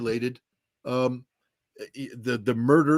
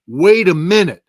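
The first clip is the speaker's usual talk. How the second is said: loud, higher-pitched halting exclamation; loud disbelief; 6 semitones higher, 10 dB louder than usual. Retold or imitated. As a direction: stop everything, louder and higher. Acts out his dawning disbelief.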